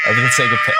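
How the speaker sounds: high laughing squeal. Real, not acted. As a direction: laugh through the words. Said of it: "What you mean?".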